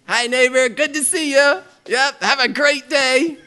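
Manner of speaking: paste on a big fake smile and greet; fake cheerful greeting. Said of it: "smiles at his neighbor".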